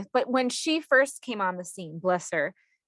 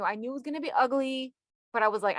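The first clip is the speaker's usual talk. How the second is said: ordinary talk, nothing marked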